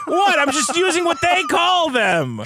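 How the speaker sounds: very high, squealed self-defence; mock protest. Co-host defends using the British word, high mock protest. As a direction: protest innocence in a high pitch.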